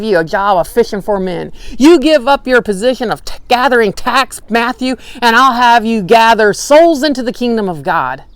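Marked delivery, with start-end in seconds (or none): none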